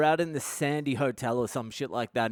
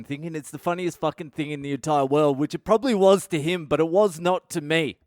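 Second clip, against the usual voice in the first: normal talking voice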